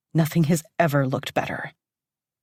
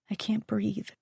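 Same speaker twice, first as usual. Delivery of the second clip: plain reading voice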